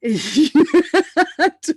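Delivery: high, loud embarrassed giggle; loud nervous giggling; nervous laugh. Real, not acted. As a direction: giggle before the confession. About to confess the phobia.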